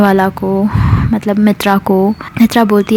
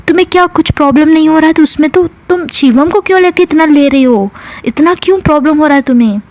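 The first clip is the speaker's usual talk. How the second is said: higher, fast indignant protest; acted anger; 7 semitones higher, faster than usual. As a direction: fire off offended questions in a retold quarrel. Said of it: "यहाँ मित्रा को गुस्सा आता है". (गुस्से में तेज़ शिकायत)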